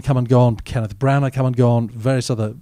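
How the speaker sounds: normal talking voice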